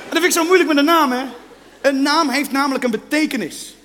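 usual speaking voice

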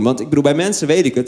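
ordinary talk

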